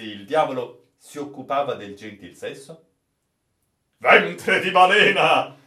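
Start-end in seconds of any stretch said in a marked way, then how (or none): 4.0-5.6 loud, higher sailor's oath; loud exclamation. Acted. The astonished captain swears loudly at the old sailor's news. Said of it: "esclamò il capitano".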